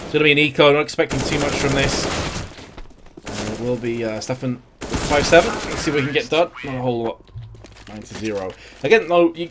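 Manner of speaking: energetic esports caster calling the action; live play-by-play. Acted. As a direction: call every duel as it happens.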